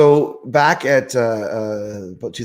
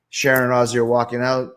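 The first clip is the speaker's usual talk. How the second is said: usual speaking voice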